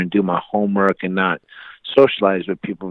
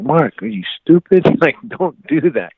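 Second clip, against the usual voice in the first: higher, incredulous dad telling off his son; exasperated parent's reprimand; scolding dad; 7 semitones higher, 4 dB louder than usual. Acted. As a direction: scold him in disbelief, pitch jumping up.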